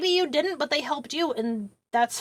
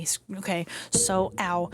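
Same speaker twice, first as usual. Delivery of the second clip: ordinary delivery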